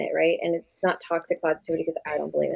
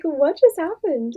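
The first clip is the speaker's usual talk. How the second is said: baffled; 10 semitones higher, 4 dB louder, much slower than usual. Retold or imitated. Stunned after he hung up.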